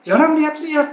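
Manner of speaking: high, loud imitated scolding; retold angry shout. Gate guard scolds the driver to watch the road, retold angry shout. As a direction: tell someone off, mimicking an irritated guard. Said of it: "dia teriak-teriak".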